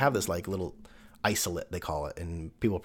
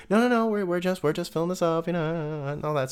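pitched-up, airy reassurance; higher breezy nurse; 9 semitones higher, 3 dB louder than usual. Retold or imitated. NICU staff brushing off the parents' worry, higher and airy.

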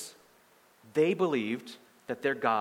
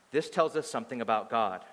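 ordinary talk, nothing marked

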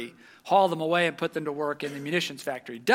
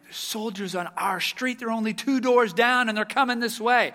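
urgent, rising panic, louder as it goes; alarmed warning; 7 semitones higher, 6 dB louder than usual. Retold or imitated. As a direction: warn the family the soldiers are coming. Boys run in warning family.

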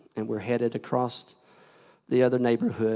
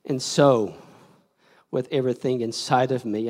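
ordinary talk

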